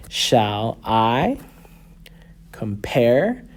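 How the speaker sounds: "Shall I compare" slowly in pairs, rising on I and pare; second syllable stressed. Shown as a demonstration. Teacher showing unstressed then stressed, second syllable stressed. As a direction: lean on I and pare, pausing between "Shall I" and "compare". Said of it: "is not said as emphatically as".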